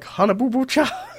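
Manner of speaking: high squealing mock shout; Honey Boo Boo shout. Mimicking a reality-show kid with a squealing shout. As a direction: shriek it like a hyper kid. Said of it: "he's like".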